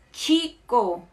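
chico with TCH, as tchiko; Spanish CH. Shown as a demonstration. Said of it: "som de T C H".

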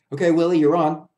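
loud backstage call, high and bright. A narrator's reading. Backstage man calls Willie onstage, loud call. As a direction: call the clown on stage loudly.

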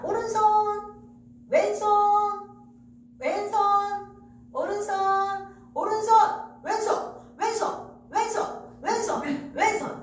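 high, loud calling of cues. Acted. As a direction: call each hand out loud. Calling out left and right.

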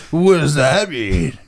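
slow, dopey put-on voice; mock-dumb question. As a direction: play him as slow-witted. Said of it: "You go".

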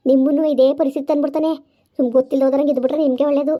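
low, fast ghost urging escape; urgent ghost. Acted. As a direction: hurry them out, low and pressing. Ghost urges them to escape. (ತಗ್ಗಿದ ವೇಗದ ದೆವ್ವದ ಎಚ್ಚರಿಕೆ)

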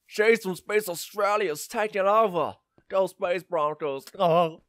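loud, high, slow mock Australian; Australian accent. Player announcing a space takeover, exaggerated Australian accent. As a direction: draw it out in a hammed-up Australian accent. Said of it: "an Australian accent by default".

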